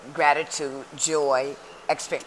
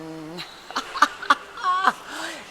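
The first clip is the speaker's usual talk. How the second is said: big bright burst of laughter; high hearty laugh; 12 semitones higher, 4 dB louder than usual. Real, not acted. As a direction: laugh out loud, high and free. Greets viewers, laughs heartily.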